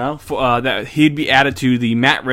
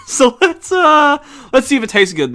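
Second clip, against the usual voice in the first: laughing; 11 semitones higher, 5 dB louder than usual. Real, not acted. Laughing while bracing to taste.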